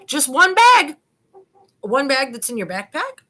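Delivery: high, loud disbelieving question; shocked detective voice; incredulous. Retold or imitated. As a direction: ask it like you can't believe it. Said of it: "Patrick Murphy says".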